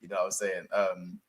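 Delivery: ordinary talk, nothing marked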